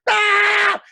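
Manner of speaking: high loud yelp mid-flight; high shout; flying yell. Acted. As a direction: sail through the air yelling. Frog flies through air.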